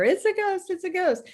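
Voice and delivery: high, thin scared voice; mock frightened cry. Disciples screaming at the ghost, high frightened voice. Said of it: "screaming and crying out in fear".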